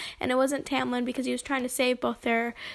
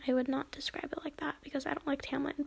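whispered aside; 8 dB quieter, faster than usual. Real, not acted. Personal aside against the character.

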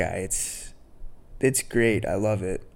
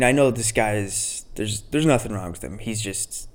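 ordinary talk, nothing marked

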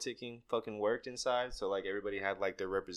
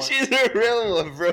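laughing; 13 semitones higher, 10 dB louder than usual. Real, not acted. Laughing repeat.

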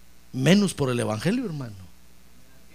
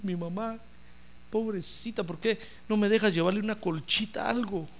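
soft, high, tearful begging; tearful plea; 4 semitones higher, 7 dB quieter than usual. Retold or imitated. Daughter begging the Lord for her mother, softly tearful. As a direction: beg quietly, voice lifted as if crying. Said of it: "Y empezó a llorar y decirle".

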